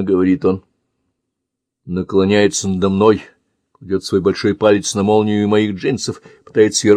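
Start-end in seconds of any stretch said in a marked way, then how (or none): none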